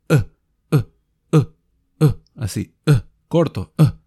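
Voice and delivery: short uh pushed from the stomach; clipped vowel demo; short uh sound. Shown as a demonstration. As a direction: punch a short "uh" from the gut. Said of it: "empujaran un poquito".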